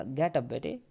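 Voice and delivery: gentle request. Shown as a demonstration. Kind teacher coaxing a shy girl up, soft request. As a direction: ask gently, no command in it. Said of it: "तो teacher ने आराम से बोला था".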